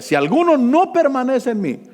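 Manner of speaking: loud, high proclaiming preacher; preaching at full force. Acted. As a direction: ring out the verse from the pulpit. (predicación a todo pulmón)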